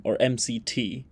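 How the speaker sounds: ordinary talk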